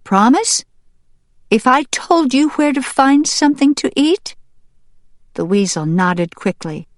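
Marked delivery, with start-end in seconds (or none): 0.0-4.4 high, questioning hen voice; hen seeking a promise. Acted. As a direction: press for a promise, pitch raised.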